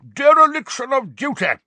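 high, loud indignant roar; red-nosed wizard roars at Phineas. Acted. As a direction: bellow the accusation. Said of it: "roared a corpulent, red-nosed wizard".

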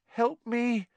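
high, frightened moan; desperate, high-pitched plea. A narrator's reading. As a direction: moan for help in panic. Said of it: "he moaned to Hermione".

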